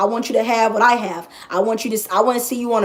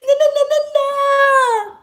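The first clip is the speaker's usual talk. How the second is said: mock whiny complainer. Mocking her old self complaining about followers, high crying whine. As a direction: whine it out, voice way up, half laughing. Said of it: "I would always be like".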